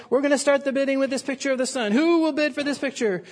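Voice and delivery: brisk mock auction call; auctioneer calling. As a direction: rattle it off fast like an auctioneer. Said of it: "The auctioneer said".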